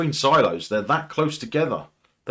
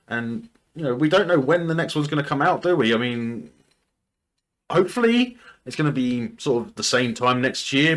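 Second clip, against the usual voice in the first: normal talking voice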